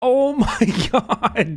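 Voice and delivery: slow, high laughing exclamation. Real, not acted. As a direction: laugh through the words. Streamer laughing at bodycam.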